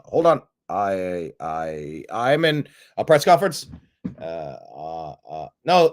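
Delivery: low stammering uhs between words; mock confused old president. Lightly mocking Biden losing track at a press conference. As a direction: fumble like a lost old man at the podium.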